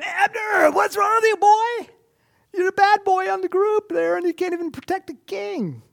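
high, loud taunting yell across the valley; mocking shout. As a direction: bellow the taunt at Abner, jeering. David taunting Abner across valley.

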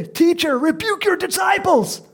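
high, loud mock-indignant complaint; indignant demand. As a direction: cry out for someone to stop this. Voices Pharisees complaining to Jesus.

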